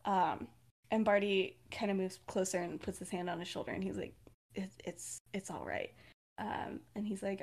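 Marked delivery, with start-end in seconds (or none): 4.6-5.9 very soft, lower, halting comfort; hushed and gentle; tender reassurance. Retold or imitated. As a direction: soothe him quietly, stumbling a little. Acted comforting, hand on shoulder.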